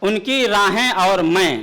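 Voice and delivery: measured, declaiming poetic delivery; ghazal recitation. Acted. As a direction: recite the couplet grandly.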